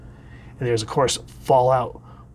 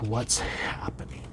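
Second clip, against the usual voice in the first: slow, bewildered whisper; confused whisper; 3 semitones lower, 10 dB quieter than usual. Acted. Revived hero wakes confused, bewildered whisper. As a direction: whisper it as if waking up lost. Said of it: "He's like".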